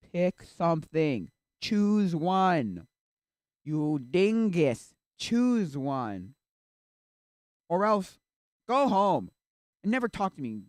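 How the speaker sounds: loud, emphatic demand; exasperated yelling. Acted. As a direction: shout each order, stretching the words. Yells demand to choose.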